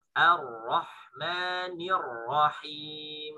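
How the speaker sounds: Ar-Rahmanir Rahim built up syllable by syllable, very slow; thin A, heavy RA, drawn out; slow tajwid recitation. Shown as a demonstration. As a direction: drill the phrase slowly for the class. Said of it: "Bacanya A, tipis, ketemu ro, tebal".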